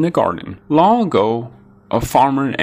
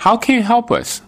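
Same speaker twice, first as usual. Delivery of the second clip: high doubtful question; 13 semitones higher than usual. A narrator's reading. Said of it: "said the farmer and his wife".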